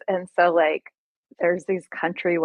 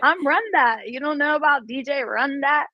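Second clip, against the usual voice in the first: higher, bragging Jamaican patois with laughs; 7 semitones higher than usual. Retold or imitated. DJ retelling a casino clapback, teasing in Jamaican patois. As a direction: show off in Jamaican patois, playful. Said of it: "I just popped off on, eh, on him on patois".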